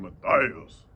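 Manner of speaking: deep, loud man's-name delivery; dropped voice. Shown as a demonstration. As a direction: boom the name out low. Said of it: "sound like a man's name".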